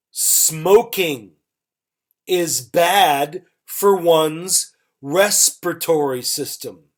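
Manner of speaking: measured teacher's example with gaps between phrases. Shown as a demonstration. Teacher models example, then repeats it faster.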